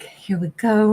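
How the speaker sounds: whispering. Real, not acted. Shuffling cards, quiet aside.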